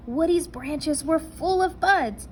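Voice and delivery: usual speaking voice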